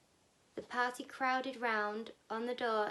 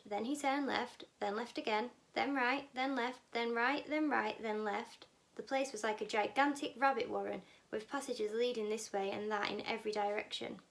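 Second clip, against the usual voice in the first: plain reading voice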